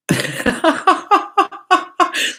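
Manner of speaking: loud squealing laugh; high burst of laughter. Real, not acted. Laughing at viewer name.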